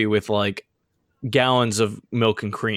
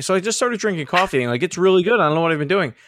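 higher, faster gushing friend voice; Brian voice; 6 semitones higher, much faster than usual. Retold or imitated. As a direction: gush about it like a coffee newbie. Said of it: "Brian was like".

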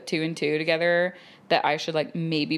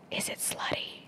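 whispered secretly, much softer; conspiratorial whisper; 13 dB quieter than usual. Retold or imitated. Older flight attendant whispering to a passenger. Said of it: "she touches my arm and she goes".

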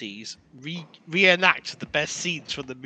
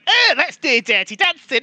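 much higher, louder cartoonish host voice; mock Keith Lemon; 10 semitones higher, 8 dB louder than usual. Mocking Keith Lemon hosting the show, high and loud. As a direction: ham up a goofy TV presenter. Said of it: "He's there going".

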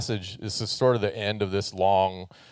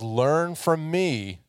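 learn from me, slow and higher; slow emphasis; 7 semitones higher, much slower than usual. Retold or imitated. Pastor quoting Jesus to the church, slow emphasis. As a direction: draw out learn from me. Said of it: "And he says".

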